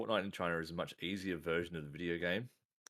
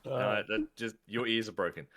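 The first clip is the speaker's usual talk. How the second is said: normal talking voice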